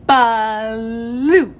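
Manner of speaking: "Blue" loud, with the L drawn out; slow exaggerated speech modeling. Shown as a demonstration. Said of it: "Let's say it again".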